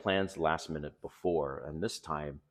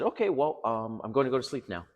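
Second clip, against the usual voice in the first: higher, lightly voiced woman brushing him off; mock woman voice; 6 semitones higher, 5 dB louder than usual. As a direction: play her breezy goodnight, a touch higher. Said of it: "she said".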